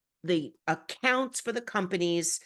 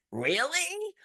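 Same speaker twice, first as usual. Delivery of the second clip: high, rising mock disbelief; sarcastic; 9 semitones higher than usual. Quoting a social media reply of hers, sarcastic. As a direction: swoop up into a sarcastic question. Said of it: "that was sarcastic".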